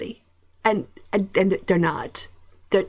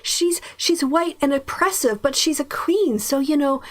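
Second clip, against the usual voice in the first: sarcastic, higher-pitched swooning; mock awe; 5 semitones higher than usual. Host mocking queen worship, sarcastic awe. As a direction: feign being dazzled by royalty. Mocking glamorised view of royalty.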